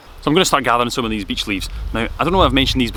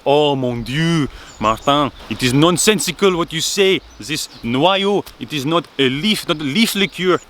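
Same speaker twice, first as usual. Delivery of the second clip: put-on higher-pitched, exasperated French accent; Armand's French style; 6 semitones higher than usual. French friend objecting to the name noyo, in an indignant French accent. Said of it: "in his characteristic French style".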